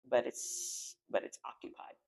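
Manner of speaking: whispered dread; hushed warning. Retold or imitated. Spies whisper the land is taken. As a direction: drop to a whisper for the bad news. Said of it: "they're like".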